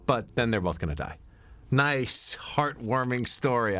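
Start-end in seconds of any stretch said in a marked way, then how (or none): 1.7-3.8 slow, drawn-out ironic approval; wry irony. Real, not acted. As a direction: stretch it out with dry irony. Ironic remark after bleak plot.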